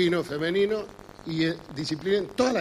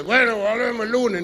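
mock shout; 4 semitones higher, 3 dB louder than usual. Friends answer the lab tech.

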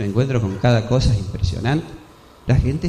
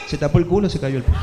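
ordinary delivery

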